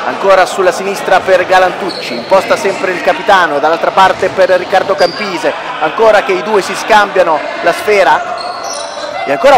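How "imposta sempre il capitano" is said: normal talking voice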